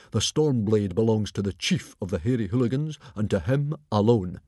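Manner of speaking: calmly authoritative, lightly voiced chief; composed, firm character voice. A narrator's reading. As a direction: lay down the law without heat. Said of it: "said Stoic calmly".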